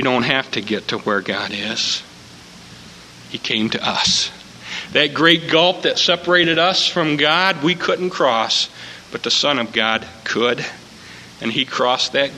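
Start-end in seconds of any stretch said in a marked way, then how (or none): none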